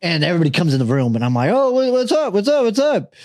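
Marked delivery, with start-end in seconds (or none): 1.5-3.1 high-pitched, repeated cover-up greeting; imitated flustered greeting. Caught with the doll as everybody enters, flustered high greeting. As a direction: act caught out and overly casual.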